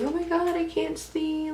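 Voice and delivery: high-pitched mock complaint; put-on high voice for "I can't see"; imitating roommates. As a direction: mimic roommates whining in a high voice. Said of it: "my roommates talk about all the time, being like".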